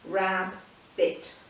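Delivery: rab-bit with a clear break between parts; slow two-beat rab-bit; split into syllables. Shown as a demonstration. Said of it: "two sounds".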